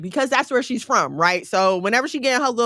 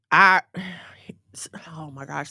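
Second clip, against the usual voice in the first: hushed, voiceless aside while searching memory; muttering to self; 7 semitones lower than usual. Real, not acted. Speaker trying to remember a verse, whispered to self. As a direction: whisper it to yourself, straining to recall.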